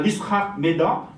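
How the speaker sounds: ordinary delivery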